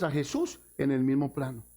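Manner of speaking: usual speaking voice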